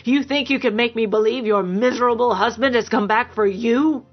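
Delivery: scornful rage, contained in volume; angry tirade. A narrator's reading. Violent man accusing his lover of a trick, angry. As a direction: spit accusations at her, disbelieving. Man rages while beating her.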